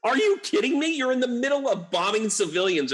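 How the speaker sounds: outraged disbelief. Real, not acted. Scolding Russia's UN diplomats, rising outraged disbelief. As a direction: climb in pitch, can't believe it.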